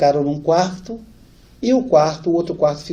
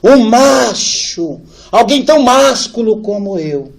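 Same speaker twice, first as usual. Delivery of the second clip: louder, puffed-up proud father voice; proud macho father; 6 semitones higher, 9 dB louder than usual. Retold or imitated. Son imitating his father's imagined boast, proud and loud. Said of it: "o senhor deve ter pensado assim".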